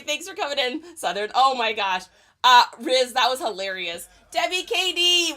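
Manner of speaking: ordinary talk, nothing marked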